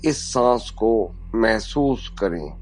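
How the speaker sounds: calm guidance, slowing toward the end; guided meditation. Acted. Yoga teacher guiding breath to the spine, slowing meditative voice.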